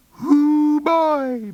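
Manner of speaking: teasing. Acted. Birdie teases after fight.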